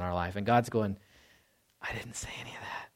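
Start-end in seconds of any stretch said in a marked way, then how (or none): 1.8-2.9 soft unvoiced whisper, calmly correcting; God whispering back to a self-condemning believer; gentle God whisper. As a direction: whisper it like a patient father. God's reply voiced.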